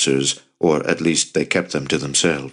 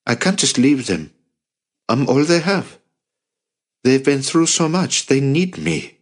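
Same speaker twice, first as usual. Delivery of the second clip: put-on higher, earnest young man's voice; a lightly raised, youthful plea; young Jacob, pleading; 8 semitones higher than usual. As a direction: Jacob refusing to abandon his family. Jacob pleading to stay with family.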